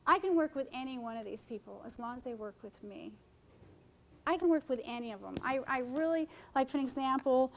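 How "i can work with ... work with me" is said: ordinary talk, nothing marked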